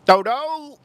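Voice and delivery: high, loud "Toto" in a put-on voice, falling at the end; mock Vietnamese accent. Streamer showing chat how his Vietnamese friends talk. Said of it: "That's how, uh, the Vietnamese talk".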